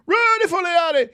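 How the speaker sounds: high, loud mocking squeal of the name. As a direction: squeal the nickname to mock him.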